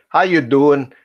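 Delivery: "how you doing" as New Yorkers say it; New York accent. Acted. Listing New York sayings for friends, New York accent. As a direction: give the stock New York greeting. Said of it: "two things they say in New York".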